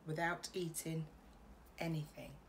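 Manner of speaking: ordinary delivery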